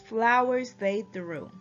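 ordinary delivery

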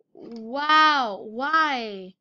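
normal talking voice

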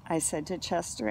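ordinary delivery

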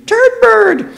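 very high, loud screech; screeching bird. A narrator's reading. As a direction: screech it like an angry parrot.

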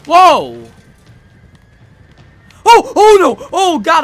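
startled scream. Real, not acted. Sudden attack by enemy.

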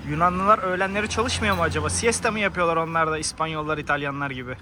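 ordinary delivery

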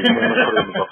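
a teasing laugh breaks into the line; giggling as you deliver the joke. Real, not acted. Joking he won't pay royalties.

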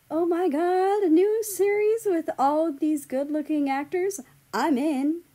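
loud, high, squealing excitement; gushing fan. A narrator's reading. Fan comments on the good-looking cast, squealing. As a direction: gush like a thrilled fan.

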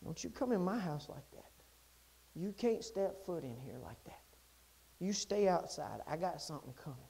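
very low, soft voice of God; hushed warning. Acted. God speaking to the unclean, hushed and solemn. God gently echoes mama's words.

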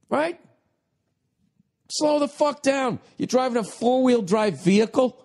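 high, strained mock yell; high-pitched rant, held back in volume. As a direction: berate the screen in a high exasperated voice. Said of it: "I'm yelling at the T V".

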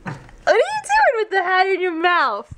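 dog-talk voice. Real, not acted. Talking to the dog.

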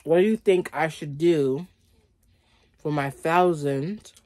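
normal talking voice

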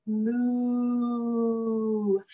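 put-on playful moo for the kids; lightly voiced cow; mock cow moo. Cow pose animal sound.

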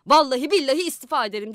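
fast, loud angry outburst; angry shout. A narrator's reading. The mayor snaps at his flatterers, fast and loud. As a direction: blurt out the threat in a rage. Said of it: "diye bağırdı".

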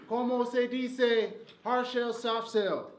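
mock Spanish.

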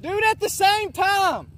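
loud, slow call across the field. Real, not acted. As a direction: shout it out slowly to people far away. Calling out to distant riders.

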